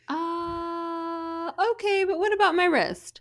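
high, incredulous patient; mock patient. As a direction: sound baffled and high. Patient reply, high pitched.

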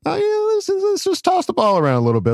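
high, fast mock coach voice; a hammed-up sly coach, falsetto; Jim Harbaugh voice. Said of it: "he might be like".

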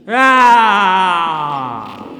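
high, loud, drawn-out shriek; long possessed shriek; shrieking cry. Acted. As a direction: let the possession tear out of her. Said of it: "only another shrieking cry".

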